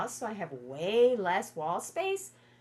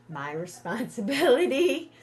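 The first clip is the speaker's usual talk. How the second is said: laughing; 6 semitones higher, 8 dB louder than usual. Real, not acted. Laughs while denying the duty.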